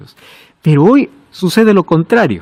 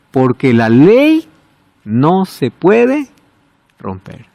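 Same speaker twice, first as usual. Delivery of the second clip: slow dramatic pauses; 2 semitones lower, much slower than usual. Acted. Preacher stresses law cannot break.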